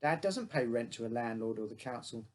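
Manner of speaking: ordinary delivery, nothing marked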